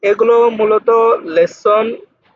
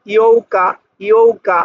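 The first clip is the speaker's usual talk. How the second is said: ordinary talk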